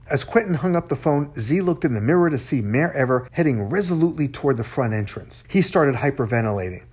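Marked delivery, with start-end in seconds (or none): none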